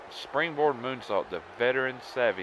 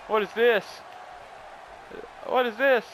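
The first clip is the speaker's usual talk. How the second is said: high, loud wrestling commentary; excited play-by-play; 12 semitones higher, 5 dB louder than usual. Pretend. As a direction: call the near fall with rising excitement. Live play-by-play of the match.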